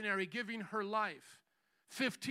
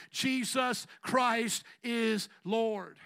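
slow proclamation; 6 dB louder, much slower than usual. Real, not acted.

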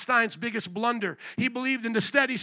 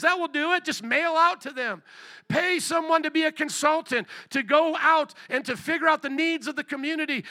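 higher, insistent voice of a sceptic; mocking doubters; 6 semitones higher, 3 dB louder than usual. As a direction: pitch it up, pushing glib advice. Acting out the doubters' advice.

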